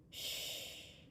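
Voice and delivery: very soft, voiceless shh; gentle hushing sound; cooed shush. A narrator's reading. Mama hushes her child against her hair, soft cooing shush. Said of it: "Mama cooed".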